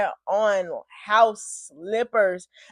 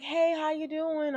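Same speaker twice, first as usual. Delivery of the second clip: higher, softer, slow greeting; lightly voiced officer; 6 semitones higher, 5 dB quieter, slower than usual. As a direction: lightly imitate a friendly officer.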